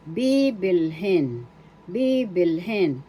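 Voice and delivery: slow "Bibilhin" with a long, accented first "bi"; BII-bil-hin, careful teacher's model; stress on first syllable. Shown as a demonstration. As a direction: draw out the opening of "Bibilhin", then fall. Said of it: "First syllable, bi, is the accent".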